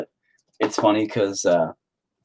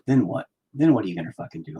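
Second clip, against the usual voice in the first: usual speaking voice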